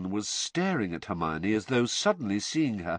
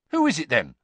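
louder, higher, sharp question; 6 semitones higher, 6 dB louder, faster than usual. A narrator's reading. Ron questions his sister, sharply. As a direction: demand the name. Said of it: "said Ron sharply".

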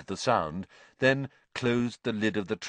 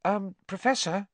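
high, tentative call at normal volume; hesitant, polite opener; cautious; 12 semitones higher than usual. A narrator's reading. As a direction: try his attention gingerly. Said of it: "approached Professor Lupin cautiously".

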